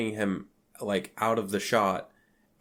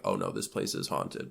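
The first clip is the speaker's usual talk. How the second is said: hushed, breathy mock dread, much lower; mock whisper; 13 semitones lower, 6 dB quieter than usual. Recapping a horror film, a hushed mock-scared whisper. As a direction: whisper it like you've just realized. Retold dread on seeing the wheelchair.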